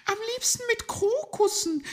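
very high, loud squeaky creature; tiny animal figure, loud and high; little animal voice. A narrator's reading. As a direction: pipe up very high. Small animal states a wish.

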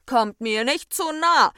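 high, loud cry; shouted warning. A narrator's reading. Abstand wards off the two troublemakers, shouting. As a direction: shout to keep them back. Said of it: "schrie der Abstand".

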